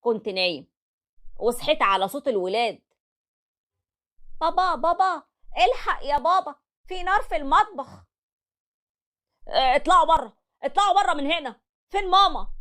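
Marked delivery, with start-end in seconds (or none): 4.4-8.1 put-on children calling. Kids wake father, fire. 9.5-12.5 higher, urgent yell to get out; panic, order the kids out; alarmed shout. Acted. Father yelling at his kids to flee the fire, alarmed.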